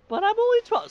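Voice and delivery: put-on high, whiny twelve-year-old; whiny kid voice. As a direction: protest like a sulky child. Voicing twelve-year-old Leon.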